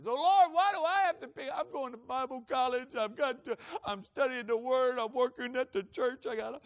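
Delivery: loud, high-pitched mock whine; whining and complaining. Young trash collector protests to the Lord, loud whining complaint. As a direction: imitate yourself griping loudly at God. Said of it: "I was whining and complaining".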